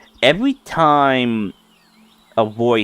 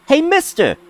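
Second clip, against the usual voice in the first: 1 pestering fairy voice; 13 semitones higher than usual. Acted. Fairy pestering the stranger like Stewie nagging mom, high voice. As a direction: call again and again like a nagging child. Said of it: "Like, like Stewie saying, "Mom, mom, mom".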